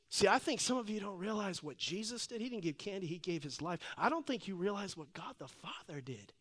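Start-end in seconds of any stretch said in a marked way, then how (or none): none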